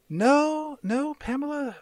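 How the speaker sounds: high, drawn-out soothing no; patronizing high-pitched denial; placating denial. Acted. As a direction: fob her off sweetly in a lifted voice. Mother-in-law fobbing off Pamela's questions.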